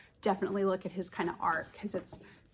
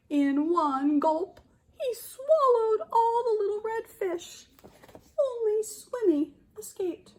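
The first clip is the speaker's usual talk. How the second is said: higher, louder and more dramatic read-aloud; dramatic storybook narration; 5 semitones higher, 3 dB louder than usual. A narrator's reading. Teacher dramatizing the tuna attack for children, higher and louder. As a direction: play up the tuna attack for the kids.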